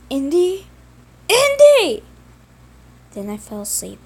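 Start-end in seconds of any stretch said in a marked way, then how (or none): 0.1-0.7 a first call, moderately raised and higher. Acted. Simon calling to his brother, a first raised call. Simon calls as Andy is taken. 1.3-2.0 high, loud drawn-out scream. Acted. Simon screaming for his brother, much higher and louder.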